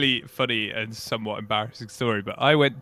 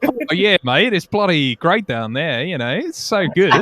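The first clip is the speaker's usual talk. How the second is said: mock Australian; 4 semitones higher, slower than usual. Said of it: "I was like".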